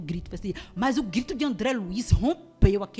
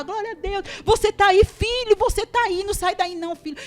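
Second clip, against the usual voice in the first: very high, loud frantic cry; reenacted mother's desperate shouting; shouted praise; 13 semitones higher, 11 dB louder than usual. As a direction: call out urgently to the child. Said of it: "eu gritava".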